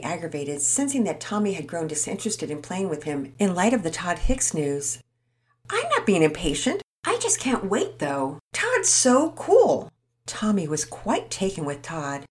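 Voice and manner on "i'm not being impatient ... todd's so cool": lightly voiced boy, high and eager; a kid who can't wait for his friend; eager young boy. Tommy gushing to Pearson about Todd, eager boy voice.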